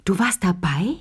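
spoken aloud, rising on „warst“; voiced disbelief. A narrator's reading. Lea repeats her astonished question to Chéri aloud. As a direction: ask it again in full voice, „warst“ rising in surprise. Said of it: "Sie hörte auf zu lachen".

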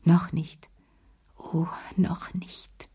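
slow breathy whisper, trailing off; whispered to herself. A narrator's reading. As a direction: whisper it to yourself, holding back. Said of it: "sagte sie sich".

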